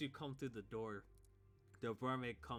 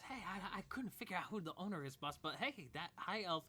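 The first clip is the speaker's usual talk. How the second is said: eager aide; 7 semitones higher than usual. Acted. DM voices the aide.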